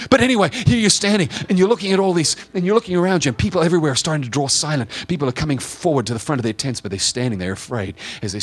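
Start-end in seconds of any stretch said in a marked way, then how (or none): 0.1-6.4 loud, high burst of storytelling; dramatic preaching. Acted. Preacher resuming the scene at the tents, dramatic and forceful. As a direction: come back in at full force, then ease.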